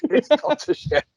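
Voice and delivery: high, laughing repeat; laughing echo. Real, not acted. Host echoing his mate's complaint about the bad line, laughing. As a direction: echo him through a laugh.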